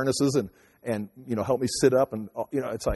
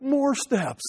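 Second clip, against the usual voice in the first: much higher, imitating dread; wife dreading more steps, in a high mock voice; mock dismay at stairs; 13 semitones higher than usual. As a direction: squeak it out like a fearful wife.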